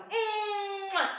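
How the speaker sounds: long high "mmm-wah" smooch; drawn-out affectionate kiss noise; big kiss. Real, not acted. Kissing her daughter Diamond.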